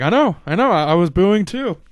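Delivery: lightly voiced, mocking agreement; sarcastic Owens. Owens mocking the booing crowd, high and sarcastic. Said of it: "and Owens is like".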